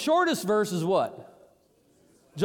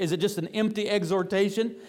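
ordinary talk, nothing marked

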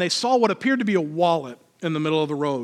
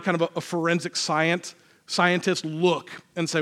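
usual speaking voice

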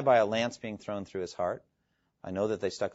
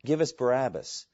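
louder, slower crowd cry; mock crowd shout; 2 semitones higher, 8 dB louder, slower than usual. Preacher voicing the crowd, mock shout. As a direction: call out the crowd's rejection. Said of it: "they shouted".

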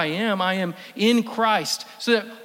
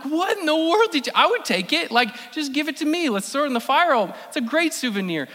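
incredulous, higher voice; 8 semitones higher than usual. Retold or imitated. Retelling shock at smashed pot.

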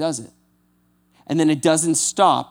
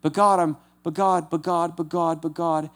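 whiny protest; 3 semitones higher than usual. Retold or imitated. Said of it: "every time God just shuts it down".

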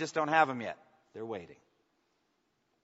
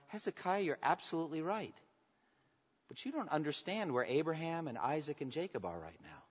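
soft, tender reply, voice held down; gentle correction; 9 dB quieter than usual. Acted. Jesus answering Hezekiah at this moment, gently. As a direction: correct him kindly and quietly.

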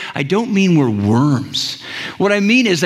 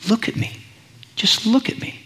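soft, higher, gentle plea; tender reassurance; 5 semitones higher, 5 dB quieter than usual. Acted. Jesus to son or daughter in hard place, tenderly. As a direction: drop the volume and coax tenderly.

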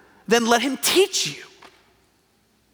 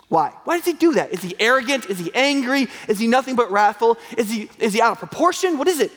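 ordinary talk, nothing marked

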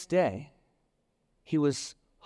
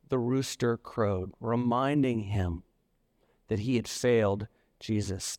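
ordinary talk, nothing marked

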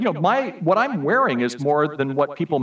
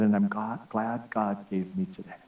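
softer, slow and frail; whisper-thin gratitude from a hospital bed; fading weak voice; 6 semitones lower, 7 dB quieter than usual. Retold or imitated. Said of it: "in a very weak voice".

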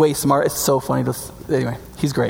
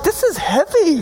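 strained lift; 6 semitones higher, slower than usual. Acted. Woman lifting a millstone.

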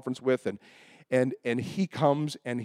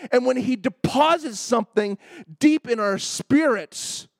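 higher, emphatic pulpit delivery; impassioned preaching; 7 semitones higher, 4 dB louder than usual. Real, not acted. Preacher teaching congregation about faith and persistence, fervent. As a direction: drive each phrase home to the congregation.

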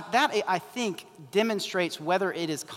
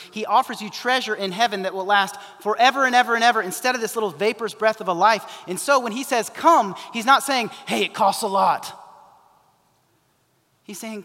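rising preaching; 4 semitones higher, 6 dB louder than usual. Real, not acted. Preaching rises to climax.

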